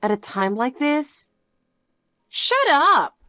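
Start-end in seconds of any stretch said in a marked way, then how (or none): 2.3-3.2 angry shout. Acted. Sister scolded for drinking.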